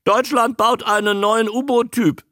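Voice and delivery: very high, squawky creature voice; high croak with a touch of worry; worried croaking newt. A narrator's reading. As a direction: report alarming news as a talking animal. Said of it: "sagte Andy besorgt".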